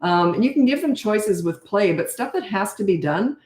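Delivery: ordinary talk, nothing marked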